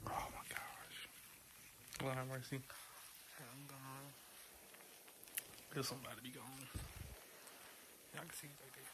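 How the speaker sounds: hushed whisper in the dentist chair. Real, not acted.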